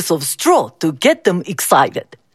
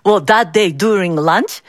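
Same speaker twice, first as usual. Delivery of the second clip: plain reading voice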